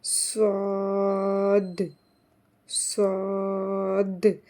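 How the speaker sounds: स्वाद slow with a thick s and clear final द; स्वाद, stretched, ending on a clear d; heavy s. Shown as a demonstration. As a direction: draw out स्वाद so the द lands. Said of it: "इसके last में आवा-, आ रही है".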